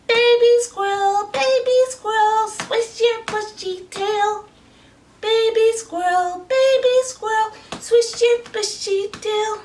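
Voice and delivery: put-on very high, tiny baby voice; baby squirrel voice. Shown as a demonstration. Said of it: "Do your best baby squirrel voice".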